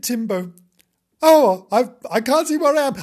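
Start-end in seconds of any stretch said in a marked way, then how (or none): 1.2-3.0 high, loud fretting; lost kitten. Acted. Kitten unable to see where he is, distressed. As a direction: fret aloud in a raised kitten voice.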